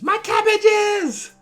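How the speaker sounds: very high, drawn-out wail of despair; Mister Cabbages wail. Retold or imitated. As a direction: wail it like the ruined cabbage merchant. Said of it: "I can be Mister Cabbages".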